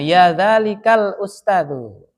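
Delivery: slow, stretched demonstration of Arabic; measured teacher's recitation; drawn-out model phrase. Shown as a demonstration. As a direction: spell out the phrase slowly, ending in a full USTADU. Model phrase with full ending.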